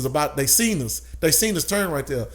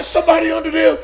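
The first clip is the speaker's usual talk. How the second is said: high, loud alarmed shout; retold police yell, pitch way up; imitated screaming cop; 13 semitones higher, 8 dB louder, faster than usual. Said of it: "he's screaming at me".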